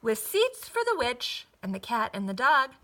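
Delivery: ordinary delivery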